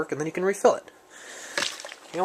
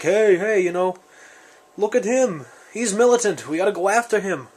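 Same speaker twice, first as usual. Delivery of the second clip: higher-pitched Brooklyn zombie voice; Brooklyn accent; 5 semitones higher than usual. Acted. Zombie pointing out prepper to fellow zombies, Brooklyn accent. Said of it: "the zombies speak in a Brooklyn accent".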